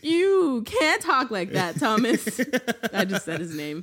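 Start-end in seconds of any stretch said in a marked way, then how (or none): 0.0-2.4 much higher, slow, cracking up mid-scold; giggly fake-stern teacher; laughing scolding. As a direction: try to scold a kid while losing it to laughter. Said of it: "And I was like".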